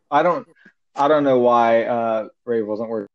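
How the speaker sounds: ordinary talk, nothing marked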